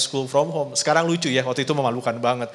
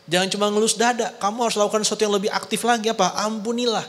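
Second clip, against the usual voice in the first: higher, pressing exhortation; Paul urging; 7 semitones higher than usual. Acted. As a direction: push them to act, like Paul. Paul urges forgiveness. (Paulus mendesak jemaat, nada tinggi)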